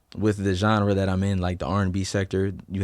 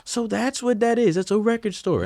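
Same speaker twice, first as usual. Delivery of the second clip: much higher, delighted 'oh snap' surprise; surprised discovery. Retold or imitated. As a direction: act out the moment you spot the store. Discovering the record store while driving.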